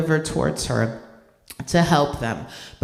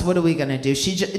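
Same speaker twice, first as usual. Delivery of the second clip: ordinary talk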